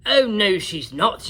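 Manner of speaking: knight yell. A narrator's reading. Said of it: "yelled Gadabout".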